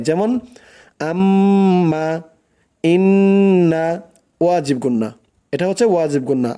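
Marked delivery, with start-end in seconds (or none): 1.1-3.9 আম্মা, ইন্না with the doubled m and n held and hummed through the nose; nasal ghunnah. Shown as a demonstration. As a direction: hum the doubled consonant long. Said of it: "নাকের বাসি হইতে গুন্নাহ পড়া হয়".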